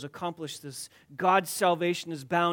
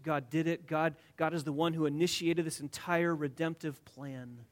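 ordinary talk, nothing marked

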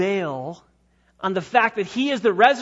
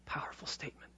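whisper; 6 semitones lower, 21 dB quieter, faster than usual. Real, not acted. Whispered aside.

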